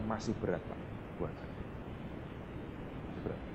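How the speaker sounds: very soft, low reluctant reply; subdued retold refusal, fading away; meek refusal. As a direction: decline almost under your breath.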